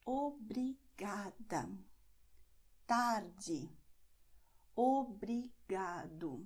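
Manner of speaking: obrigada, tarde, obrigado slowly, leaning on GA, TAR, GA; second-to-last syllable stressed. Shown as a demonstration. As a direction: sound out obrigada, tarde, obrigado with long pauses and penultimate stress. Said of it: "stress not the last syllable".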